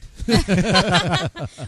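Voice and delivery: a loud burst of laughter. Real, not acted. Host laughing out loud after the guest's rant, loud laugh.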